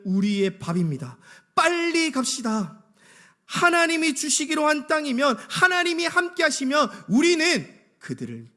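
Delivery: high, loud acted battle confidence; bold rallying. Preacher voices Joshua and Caleb urging the people on, boldly rallying. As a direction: urge them forward, stretching the call to go. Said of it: "반대로 여호수아와 갈렙은 달랐습니다".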